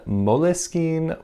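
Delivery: Moleskine as "mo-leh-skeen", three slow syllables; added middle "leh" and long ee in Moleskine; alternative pronunciation. Shown as a demonstration. Listing viewers' suggestions, Moleskine said "mo-leh-skeen". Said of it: "other recommendations for how to pronounce this".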